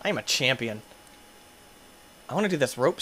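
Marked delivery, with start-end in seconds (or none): none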